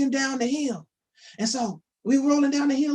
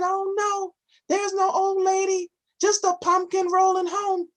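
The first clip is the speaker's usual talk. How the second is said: put-on higher, innocent little old lady; high, fibbing storybook granny; sweet old lady voice; 9 semitones higher than usual. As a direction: play the sly granny pretending.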